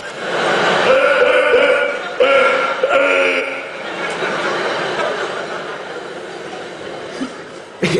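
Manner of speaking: desperate high gasps for breath; mock breathless non-laugh, sucking in air; imitated silent laugher. Comedian showing how silent laughers refuel, gasping noisily. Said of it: "they need breath to fuel".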